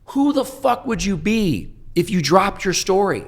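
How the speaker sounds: imitated high, loud scream of a question. Sponsor screaming at him on a Saturday night, high and loud. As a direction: hurl the question up high like an angry mentor. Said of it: "red in the face screaming at me".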